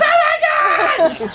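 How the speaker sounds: high, loud, stretched-out shriek; mock scream. Coffee girl seeing a real monkey, mock screaming. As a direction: scream it in exaggerated shock.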